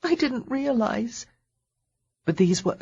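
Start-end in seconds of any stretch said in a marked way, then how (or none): 0.0-1.3 high, slow and tearful. Acted. Heroine murmuring in his arms, tearful. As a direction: let it trail off in tears.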